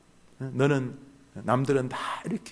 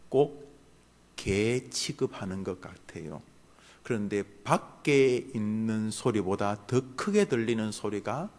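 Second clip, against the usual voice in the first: ordinary talk, nothing marked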